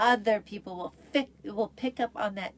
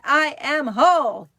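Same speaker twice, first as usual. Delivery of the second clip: loud, higher, emphatic affirmation; like meaning it; 6 semitones higher, 11 dB louder than usual. Shown as a demonstration. Host urging viewers to mean the mantra, loud and emphatic. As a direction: belt it out with full conviction. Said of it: "Say it like you mean it".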